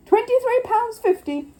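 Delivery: high, loud slow bid; excited bidding call. Acted. Gruber bidding eagerly.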